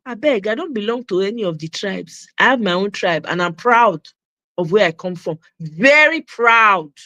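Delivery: normal talking voice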